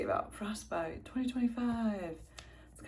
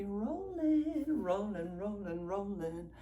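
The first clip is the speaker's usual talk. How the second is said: sing-song rolling; 3 semitones higher, much slower than usual. Real, not acted. Playful repeated rolling.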